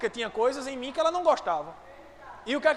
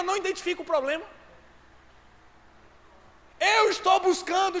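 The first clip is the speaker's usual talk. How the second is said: raised, higher preaching voice building in force; impassioned preaching; 5 semitones higher, 4 dB louder than usual. Real, not acted. Pastor preaching to the church, impassioned and loud.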